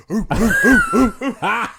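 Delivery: very high, loud hoots breaking into laughter; imitated hooting. Joke about girl on Arsenio show, high hooting. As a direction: hoot like a studio audience cheer. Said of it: "and she was like".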